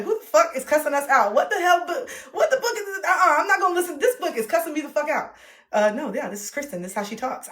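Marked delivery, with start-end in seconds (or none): 0.0-5.3 high-pitched mock outrage; imitated complaining listeners. Author voicing listeners who got the audiobook, mock indignant. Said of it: "people say".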